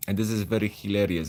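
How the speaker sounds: Polish accent. Shown as a demonstration. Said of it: "I would sound a little bit like this".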